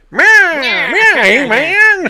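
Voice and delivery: high, loud, nasal-free cackly Skeletor. Retold or imitated. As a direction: screech it like old Skeletor. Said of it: "original Skeletor voice".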